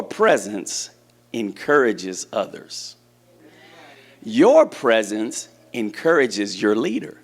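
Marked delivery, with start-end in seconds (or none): none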